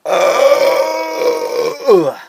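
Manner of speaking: loud high strained grunt; comic straining grunt; fake gassy groan. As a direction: groan like passing gas. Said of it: "Terribly sorry about that".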